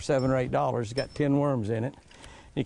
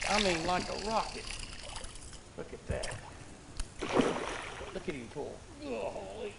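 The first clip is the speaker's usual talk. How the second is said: much softer, nearly whispered watching the fish; hushed excitement; 6 semitones higher, 10 dB quieter than usual. Real, not acted. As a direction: keep it down, tense with excitement.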